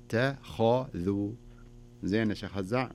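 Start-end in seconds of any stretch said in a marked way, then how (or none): 0.1-1.3 very slow "اتخذوا", light ت, full heavy خ, light ذ; correct heaviness. Shown as a demonstration. Teacher giving the right version, very slow "اتخذوا". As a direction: separate and stretch "اتخذوا", only خ heavy. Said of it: "لا، أقول".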